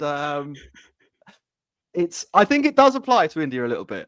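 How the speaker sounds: ordinary talk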